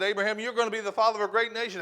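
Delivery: excited promise. Retold or imitated. God's promise recalled by Abraham, fast and loud. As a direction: rush the promise with fervour. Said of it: "God said".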